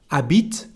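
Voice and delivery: "Habite" starting straight on the A vowel; "Habite" said as "abeet", no H; silent H. Shown as a demonstration. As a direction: drop the H of "Habite". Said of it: "the letter H".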